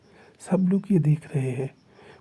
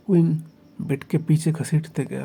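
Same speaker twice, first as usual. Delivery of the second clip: ordinary delivery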